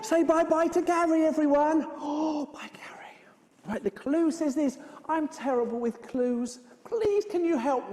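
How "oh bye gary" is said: breath of a whispered farewell; hushed goodbye. Acted. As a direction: let the farewell fade away softly. Soft farewell as pigeon leaves.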